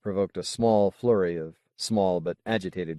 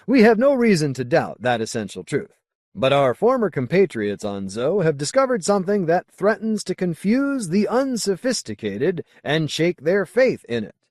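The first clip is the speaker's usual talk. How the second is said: raised, declaiming leader's address; stern colony leader; 6 semitones higher, 5 dB louder than usual. Acted. Magnus addresses his advisors, loud and declaiming. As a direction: proclaim it to the council like a zealot.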